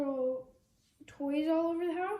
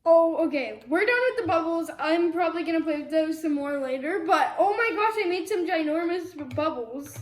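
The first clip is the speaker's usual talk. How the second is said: excited; 8 dB louder than usual. Real, not acted. Loud excited wrap-up.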